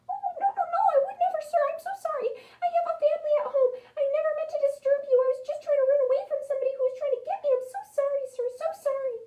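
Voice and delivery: very high, rapid squeaky babble; frantic apologetic mouse. A narrator's reading. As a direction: gush apologies in a tiny squeak.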